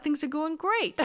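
high, sing-out mock optimism; jokey false cheer; fake cheer. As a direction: announce good news you don't mean. Sarcastic fake good news.

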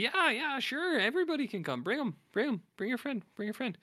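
high, eager, over-keen yes; eager invite. Retold or imitated. Walt eagerly inviting boys.

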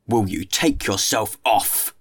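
slow, forceful command; menacing dismissal. A narrator's reading. Jean Valjean rises and scares off the boy, menacing. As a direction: drive the child off, stressing each word. Man frightens the child away.

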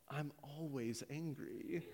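slow, hushed admission; quiet confession. Retold or imitated. Banner admitting his secret to Captain America, quiet confession. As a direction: confide it slowly and softly.

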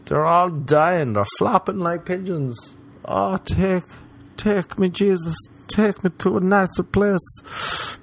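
high, slow, hammed-up dying prayer; a melodramatic last gasp to heaven, drawn out and high; mock dying plea. Comic voicing of a dying creature, a high, slow mock plea.

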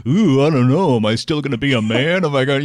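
hammed-up insecure man; mock worried voice. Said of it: "I wasn't like".

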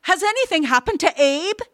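high, rushed, anxious question; breathless alarm. A narrator's reading. Mother asks after her son, breathless and urgent. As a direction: blurt it out, fearing the worst. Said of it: "Erda asked breathlessly".

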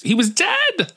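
very high, squeaky punchline; hammed-up, high-pitched gossip's shock; mock amazement. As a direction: squeal it like an ironic reveal. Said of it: "unearth my podcast and be like, "Isn't it funny?".

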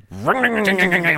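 buzzing engine noise in words; imitated engine drone. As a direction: mimic the mower's drone.

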